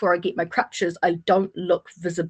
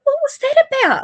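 high squeaky baffled partner; mock bewildered boyfriend asking; 5 dB louder than usual. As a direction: play the stunned partner, way up high. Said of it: "my partner was just standing there going".

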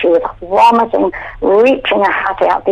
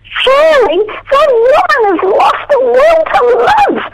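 much higher, drawn-out dawning thought; sudden realization. Retold or imitated. Lying in bed realizing the woman gave up, much higher. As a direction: let it dawn on you, high and long. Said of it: "suddenly I said to myself".

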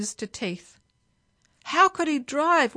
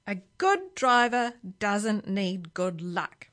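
plain reading voice

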